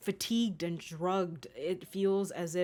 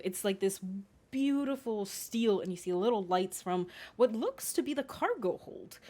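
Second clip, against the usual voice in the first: ordinary talk, nothing marked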